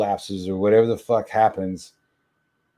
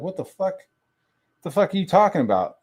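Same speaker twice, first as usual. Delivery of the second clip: high, fast disbelieving what the fuck; incredulous outburst; 10 semitones higher, faster than usual. Pretend. Reacting to a creepy comment online, high incredulous outburst. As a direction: react like you can't believe it.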